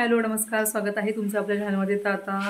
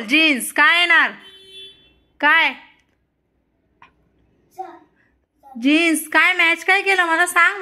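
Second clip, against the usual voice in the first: loud coaching; 7 semitones higher, 5 dB louder than usual. Real, not acted. Mother coaching child loudly. (मुलाला मोठ्याने, उंच आवाजात विचारणे)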